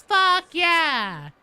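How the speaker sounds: loud, high cheer of triumph; excited yell. Real, not acted. Streamer cheering the team's win, yelling excitedly. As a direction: whoop it out like you just won.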